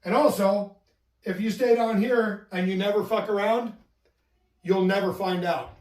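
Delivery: normal talking voice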